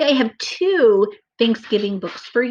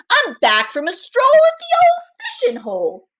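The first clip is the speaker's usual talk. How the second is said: high, put-on badger voice; a happy badger back from fishing; cheery Badger; 6 dB louder than usual.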